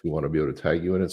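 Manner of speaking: normal talking voice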